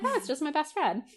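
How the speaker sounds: high, lightly mimicked innocence; oblivious. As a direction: brush it off, blissfully unaware. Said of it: "completely oblivious to that".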